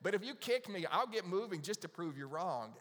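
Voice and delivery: mock stubborn churchgoer; contrary listener. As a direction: play the defiant one daring you to kick. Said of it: "the kick goes".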